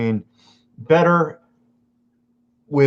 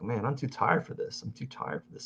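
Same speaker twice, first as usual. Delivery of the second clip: softer, fading into a whisper; tired mumble; 12 dB quieter than usual. Retold or imitated. Half-awake driver in traffic, fading into a whisper. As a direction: sag as if too tired to go on. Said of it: "and you're like".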